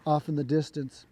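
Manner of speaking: ordinary delivery, nothing marked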